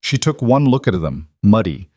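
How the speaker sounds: usual speaking voice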